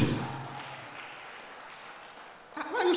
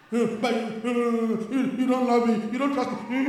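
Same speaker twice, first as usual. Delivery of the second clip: drawn-out, hammed-up tearful whine; mock crying wife; 6 semitones lower, slower than usual. Wife crying over being questioned about spending, mock tearful complaint. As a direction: imitate a wife wailing her way out of blame. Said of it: "as soon as the woman start crying".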